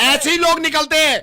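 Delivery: very high, loud angry yell; shouting. Acted. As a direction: shout him down. Phone पर चीखता हुआ झगड़ा. (गुस्से में ज़ोर से चीखते हुए)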